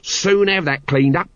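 volunteer it cheerfully in a drawn-out character voice. A narrator's reading. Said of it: "volunteered Nobby cheerfully".

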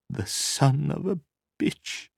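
high, slow, weak voice; thin, weak. A narrator's reading. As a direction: let it out thin and drawn out. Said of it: "in a thin, weak voice".

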